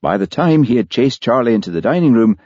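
ordinary delivery, nothing marked

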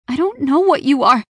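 loud, high-pitched denial; distressed protest. A narrator's reading. Raya refusing the shadowy stranger's claims, distressed and loud. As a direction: protest in rising panic.

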